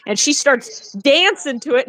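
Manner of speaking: ordinary delivery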